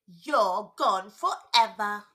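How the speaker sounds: loud, slow gloating; triumphant boy. Acted. Boy gloating over the cut-up dolls, loud and slow. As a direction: boom it out like he has won. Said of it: "he said".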